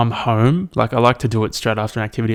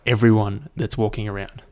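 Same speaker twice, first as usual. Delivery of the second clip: ordinary delivery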